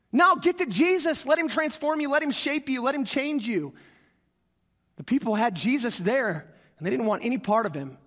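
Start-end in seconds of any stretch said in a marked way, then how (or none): 0.1-3.7 fervent exhortation. Acted. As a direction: urge the congregation to come to Jesus. Preacher's climax exhortation.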